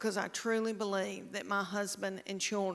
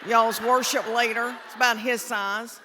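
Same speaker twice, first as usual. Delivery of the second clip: calling out; 8 dB louder than usual. Real, not acted. Comedian shouting a name-check to the audience, loud call-out. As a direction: shout it out to the room.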